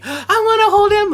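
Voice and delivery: put-on eager kid. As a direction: ask for the toy like a little kid. Quoting a kid at the store.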